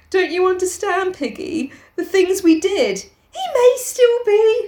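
high pleading, climbing to a drawn-out end; anguished plea. Acted. Ralph begging Piggy to understand, anguished.

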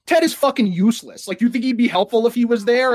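high, mocking voice of Republicans; mocking GOP critic. Scoffing at Ted's critics, high mock voice. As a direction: sneer it like a smug party critic. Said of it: "the GOP response to, like, him going on vacation was".

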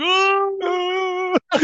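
high cartoon yelp of falling; high falling cry. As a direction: yelp as if tumbling down. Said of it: "as Mario's going".

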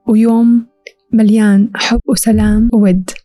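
ordinary talk, nothing marked

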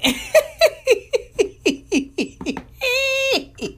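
high, crying laughter; high laughing fit. Real, not acted. Cracking up at the diss track, high crying laughter. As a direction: laugh till it nearly turns to tears.

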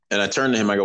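normal talking voice

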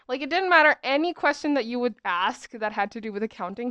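ordinary talk, nothing marked

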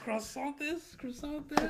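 high mock French voice; hammed-up French accent. Joining French accent joke.

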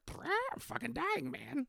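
high, strained dying parrot. Pretend. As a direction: croak out your last words as a sick parrot.

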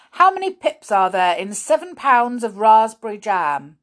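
loud, high demanding question; stern schoolmaster. A narrator's reading. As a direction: fire the question at a pupil. Grimm quizzing Molly.

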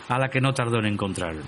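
usual speaking voice

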